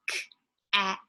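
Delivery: "k" then "at", split apart slowly; sounding out cat. Shown as a demonstration. Teacher showing parents how to split cat into sounds, slowly. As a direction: say "k" and "at" as separate, slow sound chunks. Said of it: "if I said the word cat".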